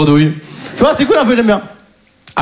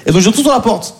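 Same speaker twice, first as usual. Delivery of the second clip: ordinary delivery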